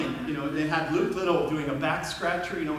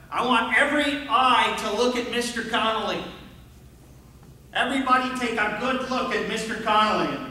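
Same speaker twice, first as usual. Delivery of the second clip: higher, louder teacher addressing the class; distinctive coach voice; 4 semitones higher, 4 dB louder than usual. Retold or imitated. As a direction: imitate a gym teacher's formal announcement. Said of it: "in that very distinctive voice".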